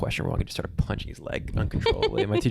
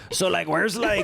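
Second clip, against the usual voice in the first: higher, hammed-up French accent; mock French accent; 10 semitones higher, slower than usual. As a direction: play a cartoon Frenchman, voice up high. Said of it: "my French, I'm like".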